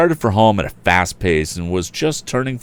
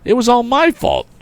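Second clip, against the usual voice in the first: declaring; 3 dB louder than usual. A narrator's reading. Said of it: "declared the young inventor".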